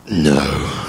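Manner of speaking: low croaky morning voice, a laugh in it; sinister croak. Retold or imitated. Answering the till lady in a sinister croak. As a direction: rasp out a gruff no. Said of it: "I came across as very sinister".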